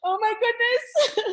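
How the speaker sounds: real shock and joy, choking up; overwhelmed surprise. Real, not acted. Winner hearing her name called, tearful surprise.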